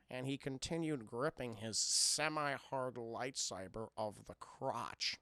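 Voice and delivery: a hint of George Lucas. Streamer reads erotic fan fiction to chat, lightly doing George Lucas. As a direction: read it low and dry like the director. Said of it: "in the George Lucas voice".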